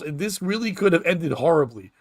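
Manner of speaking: ordinary delivery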